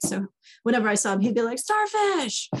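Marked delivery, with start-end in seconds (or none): 1.6-2.5 high, loud call down a hallway. Retold or imitated. Colleague greeting the speaker down the hallway, high loud call. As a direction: imitate him hollering the nickname. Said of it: "very loudly down the hallway".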